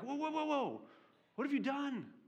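high, stretched out whoa of alarm; alarmed sailors. Pretend. Sailors hearing whose God made the sea, high alarmed whoa. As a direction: recoil in shock. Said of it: "And so they're like".

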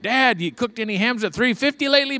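put-on high, teasing kid voice. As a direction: tease dad about the ham. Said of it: "my kids tell me".

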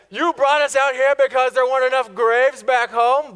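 mock the Israelites' complaint; sarcastic retort. Preacher mocking Israelites' jab at Moses, high sarcastic whine. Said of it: "And that is so sarcastic".

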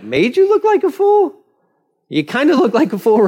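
very high mock-incredulous question; incredulous echo. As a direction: throw it back in high-pitched disbelief. Servants mock Balaam's complaint.

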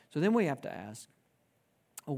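ordinary delivery

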